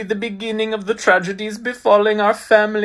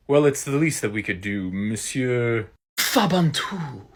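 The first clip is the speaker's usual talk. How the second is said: plain reading voice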